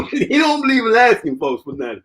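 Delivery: high, amused down-home phrasing, lightly done; a hint of his father's country talk, said high with a laugh; lightly voiced proud father. Imitating his proud dad's talk.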